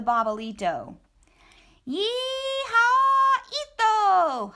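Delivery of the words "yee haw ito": much higher, loud whooping yee-haw; cowboy holler. Acted. Scapito riding the Babalito, whooping holler. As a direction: ride it like a bronco. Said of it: "hollered Scapito".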